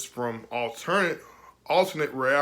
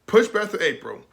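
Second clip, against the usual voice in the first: upset emphasis; 8 semitones higher, 6 dB louder than usual. Real, not acted. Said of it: "I am a little bit upset".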